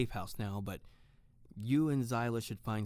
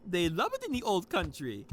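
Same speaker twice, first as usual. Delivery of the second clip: cheerful Hans; 9 semitones higher, 4 dB louder than usual. Acted. Hans calling out to Tiffany that the toast is ready, high and cheerful. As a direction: bounce it high like a jolly host.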